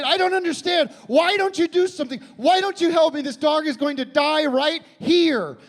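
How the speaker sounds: high, loud frantic yelling; frantic shout. Retold or imitated. Dog owner yelling at the vet clerk, desperate. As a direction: shout at her in disbelief. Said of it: "I said".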